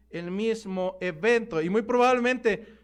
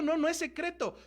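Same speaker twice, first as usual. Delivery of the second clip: high but softer, settling reply; confirming answer; 5 semitones higher, 4 dB quieter than usual. Acted. Preacher answers the listener that it is no secret, light confirmation.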